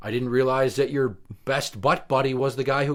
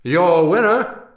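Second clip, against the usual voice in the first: grand entrance announcement; 4 semitones higher, 6 dB louder than usual. Acted. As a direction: announce the winner to the arena. Announcing the winner.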